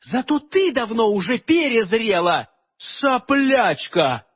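high shrill insults, dragged out slowly; indignant outburst. A narrator's reading. Aleonora insulting Morgana in indignant rage. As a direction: spit out each insult. Girl hurling insults at queen.

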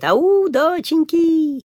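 high, very slow, long ringing call; drawn-out answering call. Acted. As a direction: stretch the call out so it carries.

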